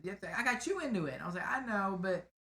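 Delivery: normal talking voice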